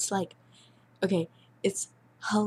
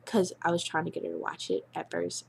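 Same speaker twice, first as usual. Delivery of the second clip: ordinary talk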